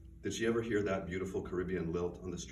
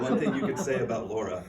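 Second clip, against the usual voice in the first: laughing restart; 3 semitones higher, 8 dB louder than usual. Real, not acted. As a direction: still laughing, voice a bit louder. Laughing attempt to restart reading.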